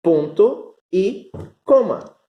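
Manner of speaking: teacher modelling the Spanish term; Spanish model word. Shown as a demonstration. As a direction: model "Ponto e coma" for students, stressing "coma". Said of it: "É o nosso ponto e vírgula".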